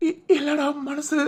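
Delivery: high, broken stammer through tears; crying. Acted. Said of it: "முத அழுகையை நிப்பாட்டு". (அழுகையில் தடுமாறும் குரல்)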